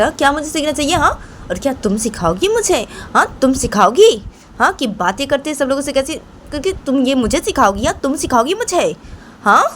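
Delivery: high, heated, rapid-fire scolding questions; indignant grandmother. Acted. Grandmother confronts Rahma for daring to teach her, indignant. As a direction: scold indignantly, pitch jumping up on each challenge. Voices the offended grandmother character.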